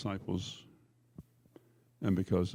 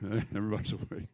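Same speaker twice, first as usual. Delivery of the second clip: quieter, laughing through the words; 5 dB quieter than usual. Real, not acted. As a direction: chuckle as you repeat it. Repeats joke with a laugh.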